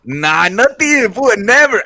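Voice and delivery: loud, high, drawn-out protest; emphatic denial. Real, not acted. Denying to a co-host that he got away with it, emphatic denial. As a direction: protest loudly, voice pitched right up. Loudly denies getting away with it.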